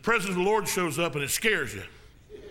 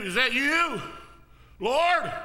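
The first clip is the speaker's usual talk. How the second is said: called out higher and louder, building; rising excited call; 6 semitones higher, 3 dB louder, much slower than usual. Retold or imitated. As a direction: cry out across the water, rising. Peter calls out to Jesus.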